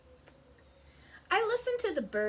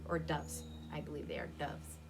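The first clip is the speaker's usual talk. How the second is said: ordinary delivery, nothing marked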